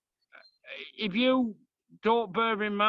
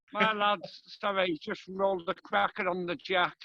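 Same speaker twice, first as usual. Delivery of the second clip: ordinary talk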